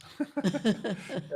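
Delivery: high-pitched burst of laughter; real laugh. Real, not acted. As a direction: laugh out at your own quip. Guest laughs after joking about hands.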